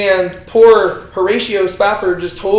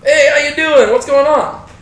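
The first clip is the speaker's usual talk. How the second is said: higher, louder casual hello; friendly greeting; 7 semitones higher, 5 dB louder than usual. Acted. Elisha greeting her on friendly terms, upbeat. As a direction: hail an old pal cheerfully. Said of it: "they are on such friendly terms".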